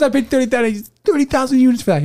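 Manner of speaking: a lightly voiced huffy raccoon; mock indignant Rocket. Retelling Rocket's leg prank, mock outraged.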